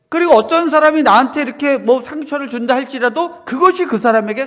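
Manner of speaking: loud, high, driving pulpit voice, slowing on key lines; fervent preaching. Acted. Pastor proclaiming God's love to the congregation, fervent preaching.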